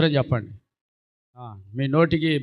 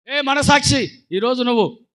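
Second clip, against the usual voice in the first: higher, loud call to the conscience; shout; 6 semitones higher, 4 dB louder, slower than usual. Acted. Preacher addressing the listener's conscience with a shout.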